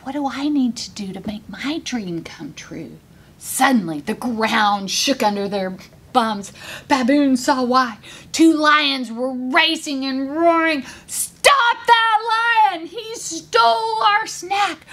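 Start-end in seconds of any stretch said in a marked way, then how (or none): 0.0-3.0 hushed, low half-whisper; quiet asking. A narrator's reading. Baboon quietly asks little hare, hushed. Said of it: "Baboon quietly asked Little Hare". 11.1-14.9 high-pitched, drawn-out cry of alarm; outraged shout. A narrator's reading. Lionesses chase the lion, shouting high. Lionesses roaring after thief.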